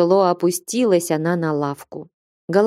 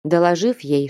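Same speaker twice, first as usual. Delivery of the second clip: ordinary delivery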